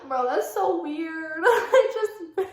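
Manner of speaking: high-pitched, cracking up mid-sentence; helpless giggles breaking up the words; laughing. Real, not acted. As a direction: try to talk through a real laughing fit. Cracks up at the story.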